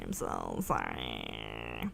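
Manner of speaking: very low, slow, soft sorry; sorry stretched into a low moan; drawn-out mock sorrow. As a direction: drag the sorry out low and quiet. Repeated apology, second one stretched.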